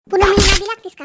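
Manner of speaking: furious shout. Acted. Shila screams at Shevanta mid-fight.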